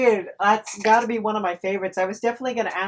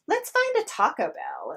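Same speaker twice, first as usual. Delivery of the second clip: mimicking girls; 9 semitones higher than usual. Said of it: "all the girls I was with were like".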